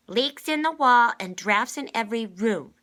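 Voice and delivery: put-on cross little girl grumbling loudly. A narrator's reading. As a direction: grumble it out, fed up. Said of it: "Constance grumbled".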